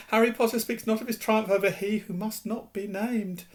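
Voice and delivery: high, hushed elf voice; reverent house elf. Acted. Dobby reverent.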